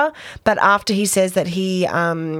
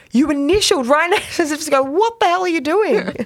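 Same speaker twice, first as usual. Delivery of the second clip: higher, pointed disbelief; incredulous; 8 semitones higher than usual. Retold or imitated. Podcaster mimicking the lawyer pointing at the paper, higher and incredulous. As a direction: jab at the contract as if asking what the hell. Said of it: "Just the way he, he says".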